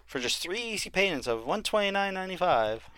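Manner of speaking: salesman reading the price; infomercial pitch. Pretend. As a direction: hype the easy payments.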